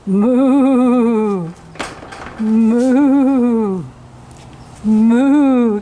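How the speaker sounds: low drawn-out moo; cow moo. Shown as a demonstration. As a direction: moo like a cow to coax her. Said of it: "You hear me saying your sound".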